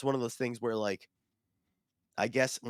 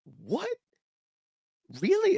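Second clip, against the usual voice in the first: high-pitched, swooping incredulous question; indignant disbelief; 4 dB louder, slower than usual. Retold or imitated. Player learning the answer was glass, indignant and incredulous. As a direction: build from squeaked shock to loud disbelief. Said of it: "I got angry at her".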